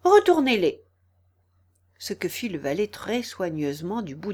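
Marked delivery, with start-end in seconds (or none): 0.0-0.8 high, loud, cross command; angry order. A narrator's reading. Angry Queen ordering the valet, high and loud. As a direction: order it angrily. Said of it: "avec colère et dit au valet".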